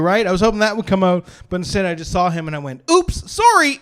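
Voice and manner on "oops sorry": suddenly very high, loud flustered apology; squeaky startled apology. Retold or imitated. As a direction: blurt it out high like you walked in on someone. Meek apology instead of tough voice.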